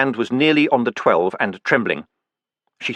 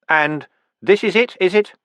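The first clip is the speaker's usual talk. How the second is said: higher, urgent question; Vimes insisting; 8 semitones higher than usual. A narrator's reading. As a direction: press him for the truth. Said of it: "he insisted".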